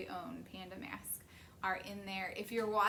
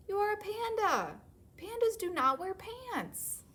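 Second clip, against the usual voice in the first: much higher, louder exasperated panda; exasperated parent; 10 semitones higher, 5 dB louder than usual. Acted. Parent panda scolds the cub, higher exasperated. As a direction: throw up your hands in mock despair.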